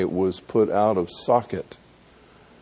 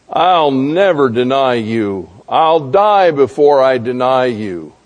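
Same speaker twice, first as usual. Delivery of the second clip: higher, louder, emphatic pledge; devoted vow; 4 semitones higher, 6 dB louder than usual. Acted. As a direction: swear it loudly, full of devotion. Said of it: "so full of commitment and devotion".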